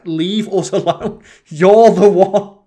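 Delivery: high, drawn-out mock yell; exasperated shouting. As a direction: bellow it slowly, acting out a row. Acted shouting match in the shop.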